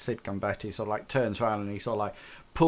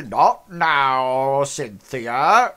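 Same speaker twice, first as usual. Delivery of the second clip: louder curt brush-off; dismissive boss; 3 semitones higher, 10 dB louder, much slower than usual. Acted. Boss brushing past his secretary, loud dismissal. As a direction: wave her off loudly. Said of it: "he says".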